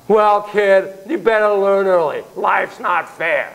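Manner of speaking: curt, lecturing put-down; dismissive parent. Retold or imitated. Parent brushing off child's complaint, dismissive. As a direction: talk down to the kid, slow at the end. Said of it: "Don't say to him".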